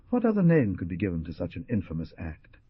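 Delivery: ordinary delivery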